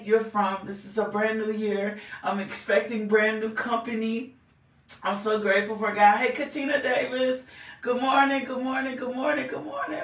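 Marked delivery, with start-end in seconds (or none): none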